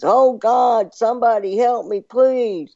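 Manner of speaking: very high pleading cry; mock screaming. Man on the cliff begging God for help, mock screaming. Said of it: "he started screaming".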